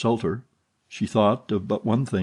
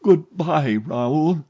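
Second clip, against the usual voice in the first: much higher, slow, aching goodbye; sorrowful farewell; 7 semitones higher, 3 dB louder, slower than usual. A narrator's reading. Heroine bidding her lover farewell, sorrowful. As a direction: let each goodbye break a little.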